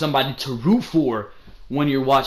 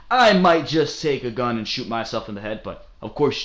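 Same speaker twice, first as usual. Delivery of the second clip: ordinary talk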